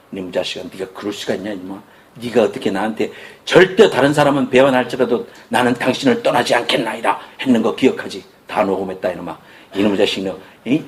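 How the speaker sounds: mock scolding. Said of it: "이러면서 다그치지 않았어요".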